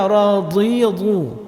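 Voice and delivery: radi lu with a loose wrong dhad; teacher showing a mistaken reading. Shown as a demonstration. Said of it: "Jangan dibaca".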